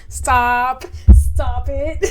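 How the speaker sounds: loud panicked stop; frightened shout. Real, not acted. Gamer startled by the game, shouting in fright. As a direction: yell it in real fright. Scared gamer reacting to jump scare.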